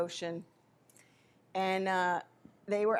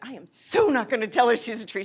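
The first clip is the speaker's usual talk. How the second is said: louder indignant no way; loud mock indignant refusal; 3 semitones higher, 9 dB louder than usual. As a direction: refuse loudly and firmly.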